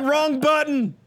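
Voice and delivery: high-pitched comic wail; hammed-up yell of someone being ejected; mock cry of dismay. Acting out the ejected listener's mishap.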